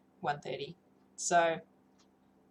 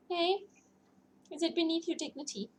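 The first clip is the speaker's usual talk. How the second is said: pet talk; 10 semitones higher than usual. Real, not acted. Owner teasing her unimpressed cat, pet voice. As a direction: tease the cat gently.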